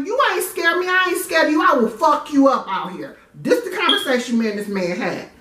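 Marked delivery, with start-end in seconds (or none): 0.0-3.2 much higher, tough threat; woman threatening the man to save face; defiant threat. Retold or imitated. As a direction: sound fearless though scared. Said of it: "my thing is to get defensive".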